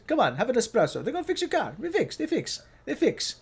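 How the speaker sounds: high-pitched mock Italian accent; Italian mechanic. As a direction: coax as a caricature Italian mechanic. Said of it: "typical Italian mechanic".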